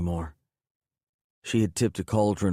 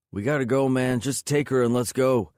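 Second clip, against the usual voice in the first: higher, pressing call from the doorway; urgent yell; 6 semitones higher, 3 dB louder than usual. A narrator's reading. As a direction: hurry them out, voice raised. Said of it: "Jake yelled from the doorway".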